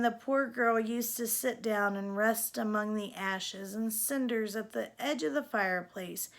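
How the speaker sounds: ordinary delivery, nothing marked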